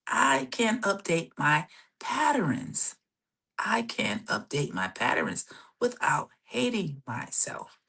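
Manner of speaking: drawn-out, deliberate affirmation; really slowly. Shown as a demonstration. Coach wanting listeners to say the affirmation, really slowly. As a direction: repeat it slowly, leaving room between phrases. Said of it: "saying this really slowly".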